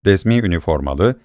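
ordinary delivery, nothing marked

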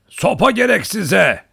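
high, loud, drawn-out holler; slow, booming old hunter's shout. A narrator's reading. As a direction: bellow it with a big hearty voice. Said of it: "o gür sesiyle".

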